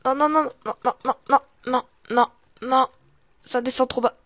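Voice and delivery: loud, rapid string of panicked refusals; alarmed. Real, not acted. Alarmed during the descent. (affolé, à voix forte)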